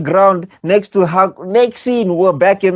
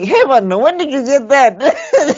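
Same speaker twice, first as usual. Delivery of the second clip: mock Naruto voice; 7 semitones higher, slower than usual. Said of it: "It's like".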